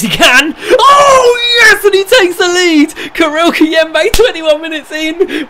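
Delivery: screamed, high-pitched goal celebration; ecstatic goal shout. Real, not acted. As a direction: roar the goal call, drawing out the words.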